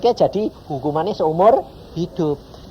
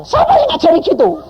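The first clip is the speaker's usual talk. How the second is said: incredulous demand; 7 dB louder than usual. Retold or imitated. Husband demands who taught this.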